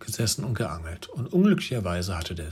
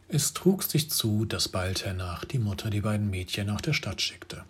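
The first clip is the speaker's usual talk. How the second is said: ordinary delivery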